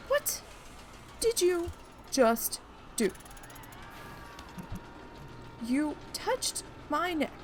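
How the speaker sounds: high-pitched indignant tirade; mad. Retold or imitated. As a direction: scold him in retold disbelief. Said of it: "oh, I was mad".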